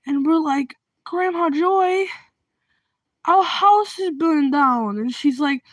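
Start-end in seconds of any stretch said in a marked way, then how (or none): 1.0-5.0 slow, raised put-on voice; mock-pained, drawn-out announcement; pained explaining. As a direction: break it to an oblivious old lady, slowly. Acts family telling neighbor bad news.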